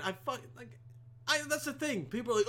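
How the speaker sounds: normal talking voice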